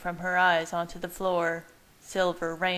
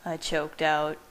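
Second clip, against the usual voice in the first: usual speaking voice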